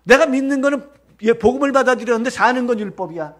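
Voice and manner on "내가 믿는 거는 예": ordinary talk, nothing marked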